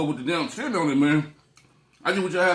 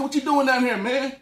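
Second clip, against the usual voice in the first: retold call; 9 semitones higher, 5 dB louder than usual. Retells questioning her downstairs.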